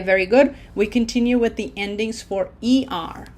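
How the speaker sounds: ordinary talk, nothing marked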